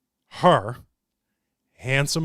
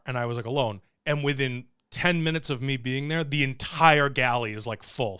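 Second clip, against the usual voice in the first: normal talking voice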